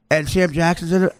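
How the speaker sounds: ordinary talk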